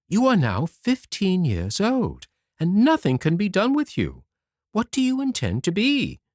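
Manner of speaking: a lightly voiced mother pressing her son; mother's voice.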